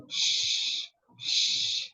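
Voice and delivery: long, voiceless shushing; drawn-out "shh" to hush someone. Acted. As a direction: shush a noisy room, twice. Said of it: "everyone say".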